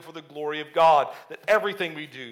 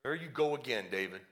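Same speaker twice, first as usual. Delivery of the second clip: low, quiet exasperation; aggravated; 6 semitones lower, 12 dB quieter than usual. Acted. Eliab to his younger brother David, quietly aggravated. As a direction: mutter it low, fed up with him. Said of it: "He's, he's aggravated".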